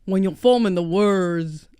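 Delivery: slow Kentucky drawl, on the deep side; practising Kentucky accent, slow and deep. Shown as a demonstration. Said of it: "But I sound deep".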